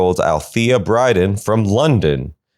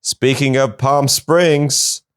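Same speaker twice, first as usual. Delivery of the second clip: ordinary delivery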